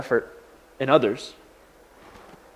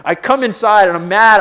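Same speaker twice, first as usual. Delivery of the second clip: ordinary talk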